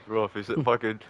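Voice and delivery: ordinary delivery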